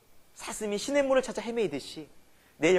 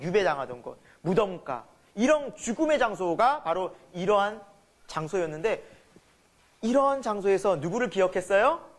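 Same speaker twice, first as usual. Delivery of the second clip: ordinary talk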